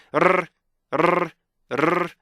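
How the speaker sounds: short bursts of rolled R; practice trills. Shown as a demonstration. Teacher drilling students, short rolled trills. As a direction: repeat brief tongue trills with gaps. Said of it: "이거를 연습하셔야 돼요".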